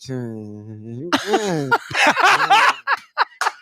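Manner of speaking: put-on forced laugh, drawn out; DeSantis. Co-host showing the candidate's attempt to smile, mimicked laugh. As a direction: imitate an awkward long laugh. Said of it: "He's like".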